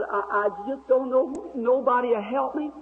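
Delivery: high imitated Southern girl's voice; high Southern-accented plea, imitated; Southern talk. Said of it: "that Southern talk".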